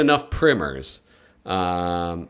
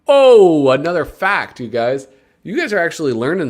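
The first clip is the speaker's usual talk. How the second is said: loud, high burst of glee; excited outburst; 6 semitones higher, 6 dB louder than usual. Real, not acted. Tells viewers about the fact, a loud excited cry.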